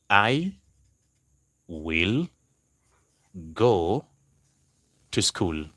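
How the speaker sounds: long pauses between I, will, go, to school; word by word. Shown as a demonstration. Teacher giving the class an example sentence, word by word. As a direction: dictate "I ... will ... go ... to school" piece by piece.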